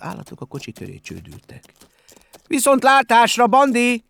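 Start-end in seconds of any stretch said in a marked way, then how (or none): 2.5-4.0 high, loud group-style call; ringing shout, pitched way up; shouted farewell. A narrator's reading. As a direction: call out goodbye as a cheering crowd. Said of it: "kiáltották kórusban".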